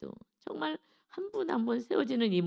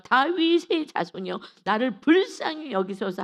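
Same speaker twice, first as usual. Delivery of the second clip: crying out louder; 4 semitones higher, 6 dB louder than usual. Acted. Silenced blind man cries out harder to Jesus, shouting. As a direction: shout for mercy, louder and higher. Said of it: "더욱 크게 소리질러".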